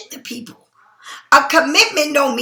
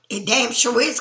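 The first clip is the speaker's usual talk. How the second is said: ordinary delivery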